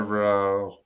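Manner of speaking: ordinary talk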